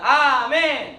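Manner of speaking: loud, full-force amen; big loud amen. Shown as a demonstration. Preacher shows students how to respond, big loud amen. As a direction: belt out a model amen. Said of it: "크게".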